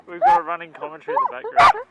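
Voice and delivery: high laughing shout. Real, not acted. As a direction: laugh it out loud over wind. Laughing on windy beach.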